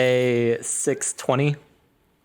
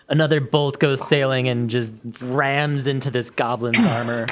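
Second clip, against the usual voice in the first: ordinary talk, nothing marked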